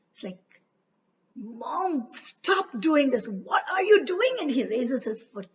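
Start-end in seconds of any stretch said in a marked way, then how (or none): 1.4-4.4 put-on rising boy's plea, louder at the end; Yama pleading. A narrator's reading. Son pleading with his mother to stop. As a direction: stop her, shocked and upset.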